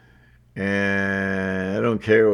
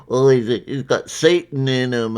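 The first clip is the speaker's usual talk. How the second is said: lightly voiced mock accuser, raised pitch; 4 semitones higher, 5 dB louder than usual. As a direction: imitate someone accusing, a bit louder and higher. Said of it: "just say".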